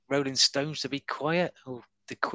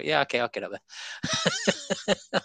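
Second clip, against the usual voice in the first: higher, very slow, laughing through it; eager acceptance, laughing; 8 semitones higher, much slower than usual. Retold or imitated. Heckler accepting a comic's invite on stage, laughing. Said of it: "Just go".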